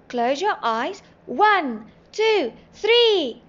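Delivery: loud count, climbing high; rising, drawn-out countdown. A narrator's reading. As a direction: count off, building to a shout on three. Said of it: "Timmy counted".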